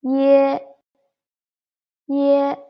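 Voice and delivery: ye said high and firm, twice; pinyin final ye. Shown as a demonstration. Teacher drills the final for learners, high and firm. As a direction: model the final ye clearly for the class. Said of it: "the final".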